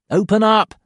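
loud, high yell. Acted. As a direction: bang on the door and yell. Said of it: "I yell".

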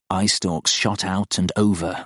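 plain reading voice

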